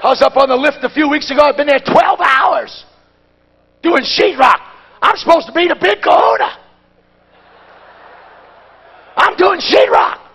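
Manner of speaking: high, loud preacher's complaint; full-force preaching. Acted. Preacher recalling hanging sheetrock, loud preaching. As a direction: preach the story with rising heat.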